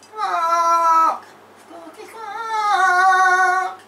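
loud, high, drawn-out squawk; stretched bird-like squawking. Pretend. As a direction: squawk it long and piercing. Playing a squawking game creature.

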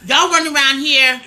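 normal talking voice

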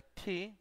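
"T" said as English "tee"; aspirated T. Shown as a demonstration. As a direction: push air out after "T". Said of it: "pero para pronunciar T".

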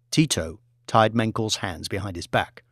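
ordinary delivery

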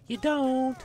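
silly high impression of a taunting enemy; mock bandit voice. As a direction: squeak it out like a mocking bandit. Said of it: "but then they're like".

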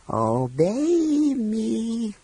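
very slow, high dragon command. A narrator's reading. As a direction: draw the order out menacingly. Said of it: "ordered the dragon".

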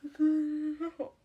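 loud, very slow, every syllable stretched out; drawn-out loud retort. Real, not acted. Streamer answering chat about the cat, loud and slow. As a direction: lean on it hard and slow, like stating the obvious.

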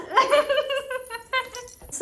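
long high-pitched laugh. Real, not acted. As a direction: let out a high delighted laugh. Baby ate it.

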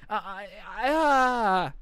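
sputter, then let out an exasperated noise; frustration noise. Shown as a demonstration. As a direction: stammer rising into a loud frustrated groan. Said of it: "that's my frustration noise".

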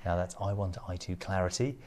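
home shopping host. Acted. Presents diamond ring slowly.

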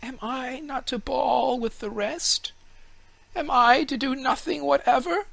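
high wistful fir tree voice; a small tree wondering if it should join in; tree's thoughts. A narrator's reading. Fir tree wonders whether to shout with the children, high and wistful. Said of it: "he thought to himself".

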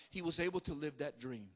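ordinary talk, nothing marked

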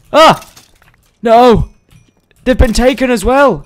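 loud, high cry of dismay; dismayed outcry. Pretend. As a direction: cry out in alarm at the loss. Finds the village empty.

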